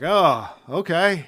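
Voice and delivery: drawn-out, let-down oh okay; slow mock disappointment; deflated oh okay. Disappointed moviegoer reaction.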